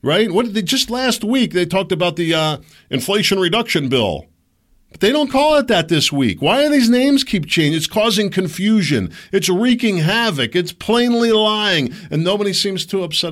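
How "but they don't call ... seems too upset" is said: louder and higher, building outrage; heated rant. Real, not acted. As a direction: vent real anger at the dishonesty. Ranting about changing bill names.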